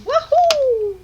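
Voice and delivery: high Mario-style wahoo; wahoo yell. Pretend. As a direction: squeal it like Mario jumping. Echoing Mario cheer.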